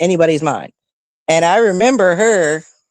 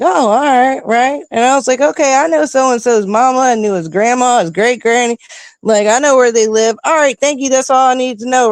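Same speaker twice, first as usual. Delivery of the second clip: relieved, excited self-quote; 7 semitones higher than usual. Retold or imitated. Mom reassured, gets the info.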